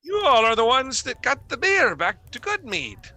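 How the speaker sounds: high, delighted outburst of a grateful official; excited recognition. Acted. Trovis thrilled to recognise the heroes, high and excited. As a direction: voice leaping up with joy and relief. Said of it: "He looks over at you and he goes".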